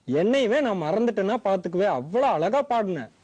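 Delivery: put-on old grandfather coaxing a crying granddaughter; kind old grandfather. Grandfather figure voiced.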